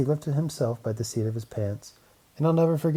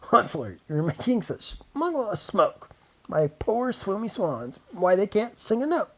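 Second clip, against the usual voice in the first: crying Lorax; 7 semitones higher than usual. A narrator's reading. Lorax crying out to the Onceler about the smog. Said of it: "he cried".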